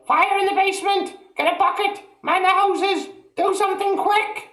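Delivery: put-on very high, loud old woman's shriek; panicked shout. A narrator's reading. Grandma shrieking for help, very high and loud.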